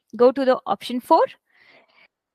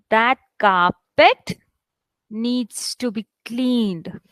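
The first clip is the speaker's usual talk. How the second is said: very slow, "carpet" lifted before a pause; teacher's drawn-out model sentence; stress demo; 2 semitones lower, much slower than usual. Shown as a demonstration. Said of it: "let me read it for you".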